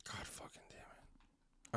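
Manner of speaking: slow, whispered frustration; whispered cursing. Real, not acted. Streamer stuck at a password screen, whispering in frustration. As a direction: curse slowly under your breath.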